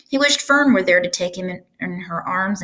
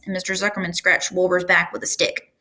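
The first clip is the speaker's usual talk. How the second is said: plain reading voice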